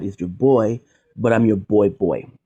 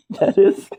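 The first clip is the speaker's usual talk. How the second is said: laughing. Real, not acted. Laughing mid-sentence.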